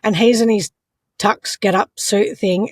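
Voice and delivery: ordinary delivery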